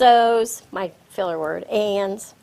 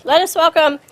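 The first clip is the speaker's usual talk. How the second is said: higher and louder, building to a rousing introduction; emcee's big welcome; 7 semitones higher, 7 dB louder than usual. Real, not acted. Emcee announcing the word master to the audience, rousing and loud. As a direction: sell the guest to the room like a host.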